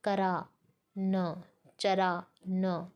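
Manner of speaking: करा न, चरा न spoken very slowly in two parts; very slow split into stressed halves. Shown as a demonstration. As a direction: pause before the final न so करा stands out. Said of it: "बलाघात के साथ उच्चारित करते हैं".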